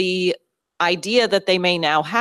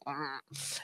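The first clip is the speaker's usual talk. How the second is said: grumpy grunt; 2 semitones lower, 19 dB quieter than usual. Retold or imitated. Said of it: "And I went".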